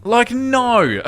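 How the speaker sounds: high-pitched, incredulous dismissal. Real, not acted. Reacting to someone calling police over a burger line, incredulous. Mocking a silly emergency call.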